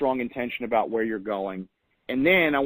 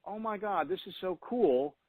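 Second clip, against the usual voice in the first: soft, higher-pitched amazed quote; hushed awe; 5 semitones higher, 7 dB quieter than usual. Acted. Voicing her own reaction to crying on the treadmill, hushed awe. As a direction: marvel quietly under your breath.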